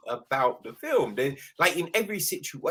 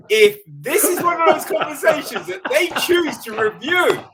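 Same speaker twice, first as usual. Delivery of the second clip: higher, louder, building to a whoop; mock excited; 10 semitones higher, 9 dB louder than usual. Mocking a recorded call, building to a whoop. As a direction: ham up the glee until the woo. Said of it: "You'd be like".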